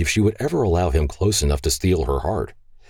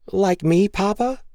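put-on high, innocent child's question; little girl voice; 14 semitones higher than usual. Grace asking her papa if she's little, high innocent child. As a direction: ask it like a small girl.

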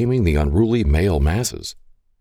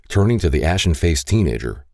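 usual speaking voice

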